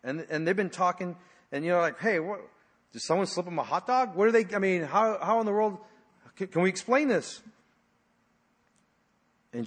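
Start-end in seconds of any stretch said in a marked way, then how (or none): 2.0-7.4 mock-baffled disciples, pitched higher; puzzled. Disciples asking each other about the food, puzzled. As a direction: act out lightly bewildered disciples, voice lifted. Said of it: "his disciples were puzzled".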